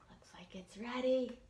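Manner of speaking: hushed gasp then whisper. Acted. Teacher whispering to children that the hot pot is ready. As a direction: whisper it like the big reveal.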